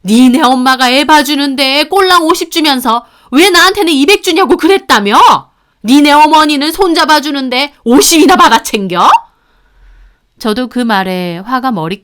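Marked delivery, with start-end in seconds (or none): 0.0-9.1 high, loud raging accusation; mother-in-law ranting at daughter-in-law over payments. Acted. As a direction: rant at her without pause. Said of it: "계속 난리치셨고요".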